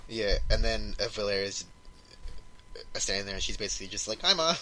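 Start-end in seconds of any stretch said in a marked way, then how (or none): 4.2-4.6 high, loud little greeting; high-pitched mock hello; imitated daughter. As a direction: pipe up as the daughter calling to mom. Voicing daughter greeting her mother.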